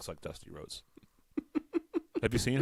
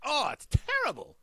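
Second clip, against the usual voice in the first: put-on high, wailing old-lady disgust; imitating disgusted woman. Woman tasting it a second time, high disgusted imitation. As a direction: mimic her repeat complaint, pitched way up. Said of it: "She'd be like".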